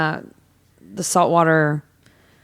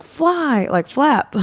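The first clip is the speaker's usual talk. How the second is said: high-pitched imitation of a hype man; mock hype-up; 10 semitones higher, much slower than usual. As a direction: call it out high to rally a crowd. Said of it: "like, hyping him up".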